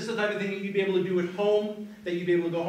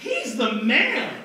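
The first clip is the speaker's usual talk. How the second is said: loud, high, stretched-out shout; top-of-lungs cheer; 5 semitones higher, 6 dB louder, much slower than usual. Shown as a demonstration. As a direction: belt it out slowly, like you mean it. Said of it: "say at the top of your lungs".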